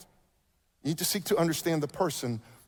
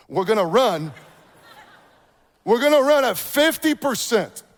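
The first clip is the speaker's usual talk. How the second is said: put-on higher, louder kid voice with a laugh; son's voice; 5 semitones higher, 6 dB louder than usual. Son telling his teammates the plan, higher and louder. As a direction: play the boy cheerfully overruling the team. Son retells coaching the kids.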